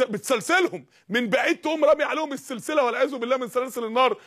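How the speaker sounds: usual speaking voice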